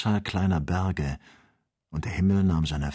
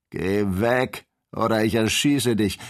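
threatening, a bit louder; mild threat; 3 semitones higher, 4 dB louder than usual. A narrator's reading. Kennedy threatening Joe while wrestling, raised voice. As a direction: warn him off, voice raised. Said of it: "wiederholte Kennedy".

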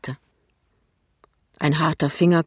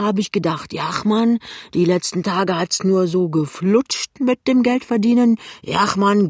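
higher-pitched, rambling tipsy man; tipsy Jachmann; 4 semitones higher, 4 dB louder than usual. Acted. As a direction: hold forth with boozy good will.

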